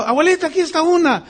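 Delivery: put-on proud child. Boy bringing the hen to his grandmother, eager call.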